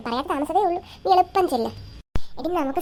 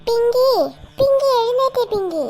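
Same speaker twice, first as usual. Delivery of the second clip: high, loud calling out; yelling to wake someone; 8 semitones higher, 6 dB louder, slower than usual. Acted. Waking a sleeping sibling, loud high yelling. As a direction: shout the sleeper awake. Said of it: "അലറി അലറി മനുഷ്യനെ പേടിപ്പിച്ച്".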